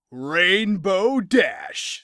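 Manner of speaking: ordinary talk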